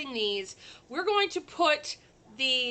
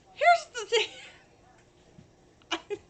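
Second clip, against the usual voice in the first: words break into a laugh; giggling mid-sentence; 13 semitones higher than usual. Real, not acted. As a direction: laugh through the start of the line. Laughing while starting sentence.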